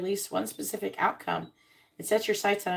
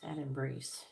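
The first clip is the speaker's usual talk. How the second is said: whispered card name; 5 semitones lower, 11 dB quieter, slower than usual. Real, not acted. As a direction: breathe the words softly.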